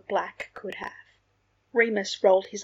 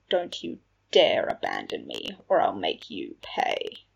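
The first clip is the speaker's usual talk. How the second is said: low, menacing warning, slowing down; threatening warning; 12 semitones lower than usual. A narrator's reading. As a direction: sink deep and slow to threaten. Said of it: "The unspoken warning was obvious".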